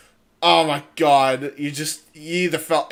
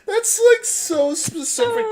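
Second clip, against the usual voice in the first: high, slow laughing wail; drawn-out laughing cry; gasping through laughter. Real, not acted. Laughing fit.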